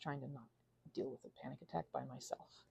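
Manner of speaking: whisper. Real, not acted. Whispering about panic.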